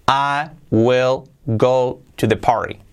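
slow, careful English model line; slow model sentence. Shown as a demonstration. Teacher modelling example sentence, slow and careful. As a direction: read I will go to the party slowly for learners. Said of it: "model sentence read slowly".

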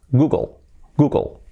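Google as "GOO-gol"; very fine L ending Google; English pronunciation. Shown as a demonstration. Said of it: "Así sería, casi".

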